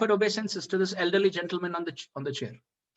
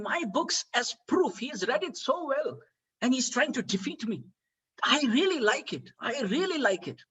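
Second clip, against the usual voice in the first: delighted praise; 6 semitones higher than usual. Retold or imitated. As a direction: act out a master's relish, stretching key words. Delighted Prabhupada praises his challenger.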